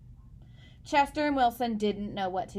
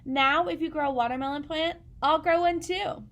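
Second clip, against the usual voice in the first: eager reassurance; 5 semitones higher, 4 dB louder than usual. Acted. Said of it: "said Chester".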